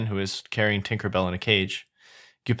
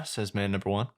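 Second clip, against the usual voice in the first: ordinary delivery, nothing marked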